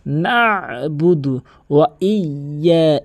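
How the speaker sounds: "নাবুদু" with a held throaty ayn, "ইয়া" doubled; correct pronunciation. Shown as a demonstration. Teacher repeating the correct reading, slow throaty recitation. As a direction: slowly stretch "নাবুদু ওয়া ইয়া". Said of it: "আইনের উচ্চারণ হলকের মাঝখান থেকে".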